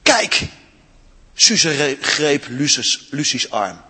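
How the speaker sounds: expressive read-aloud storytelling; story reading. A narrator's reading. Storyteller reading Narnia to children in church, expressive.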